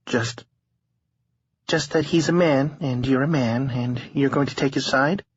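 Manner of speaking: a hint of a tired young woman. A narrator's reading. Harlow voiced in narration.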